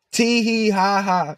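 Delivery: high, slow sing-song mock giggle; mimicking people who laughed at him. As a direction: taunt them with a silly tittering tee hee ha ha. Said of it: "laughing and shit".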